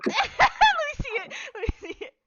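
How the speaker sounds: high, loud, helpless laughing; laughing hard. Real, not acted. Game master cracking up at the table, laughing hard. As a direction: squeal the words out through laughter.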